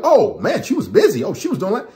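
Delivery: high, eager, lovesick guy; mock relieved boyfriend. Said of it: "You're like".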